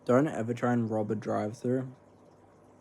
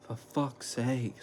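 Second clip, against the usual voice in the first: mild imitated angry curse; 4 dB quieter, much slower than usual. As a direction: mutter it slowly, a bit of his anger. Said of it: "I can't even make it as angry as what he was".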